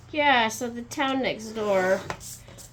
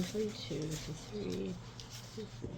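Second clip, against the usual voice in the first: quiet counting, fading softer; counting under breath; 3 semitones higher, 9 dB quieter than usual. Real, not acted. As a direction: murmur the numbers to yourself as you count. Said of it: "Hold on, I'm counting".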